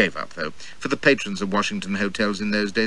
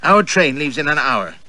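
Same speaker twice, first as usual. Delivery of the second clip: curt and louder; sharp; 5 semitones higher, 5 dB louder than usual. Acted. Annette to the narrator, sharp and curt. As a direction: cut the chatter off sharply. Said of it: "says Annette sharply".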